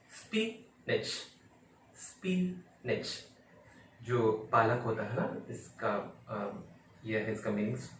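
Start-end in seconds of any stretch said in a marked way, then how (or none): 0.1-3.3 स्पिनच split slowly, spee... nich; high spee, pause, short nich for स्पिनच; slow correct pronunciation. Shown as a demonstration. Teacher drilling learners on spinach, slow split demonstration. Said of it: "इसका original pronunciation".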